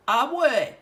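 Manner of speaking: loud squeaky wolf disguise. Acted. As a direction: squeak it out like a fake granny. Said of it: "he said in a high, squeaky voice".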